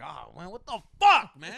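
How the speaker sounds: mock-annoyed yell, swelling on the curse; retold exasperated outburst. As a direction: imitate blowing up mid-game, loud and high. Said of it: "I used to get so mad".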